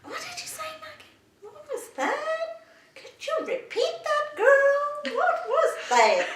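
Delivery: high-pitched, incredulous put-on American voice; mock American reaction. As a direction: squeal it like a baffled American, drawing out the words. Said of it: "They're like".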